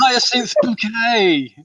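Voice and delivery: high, slow, drawn-out mock grand lady; Hyacinth Bouquet. Fan supplies the sitcom lady's name, in mock-grand imitation. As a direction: announce the name in a stretched, lofty imitation. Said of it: "she didn't like you to call her".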